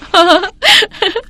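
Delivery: high sneering laugh; mocking cackle. Bride cackles at the poor couple, high and mocking. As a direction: laugh them down.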